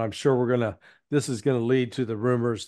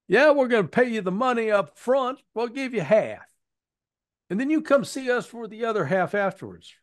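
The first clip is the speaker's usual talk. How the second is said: mocking hillbilly accent, much higher pitch; goofy hillbilly accent for the recruiter's offer; 10 semitones higher than usual. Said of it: "Sorry for the hillbilly accent".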